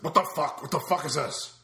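loud indignant what the fuck; mock outraged swearing; angry retold outburst. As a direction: blurt it out, outraged. Dave angry about middle seat.

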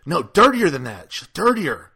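put-on girl insisting on dirtier talk; insistent demand. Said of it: "she's like".

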